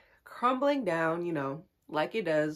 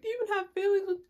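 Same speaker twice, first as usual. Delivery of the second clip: mock hurt pleading; 13 semitones higher, much faster than usual. Acts out her hurt question.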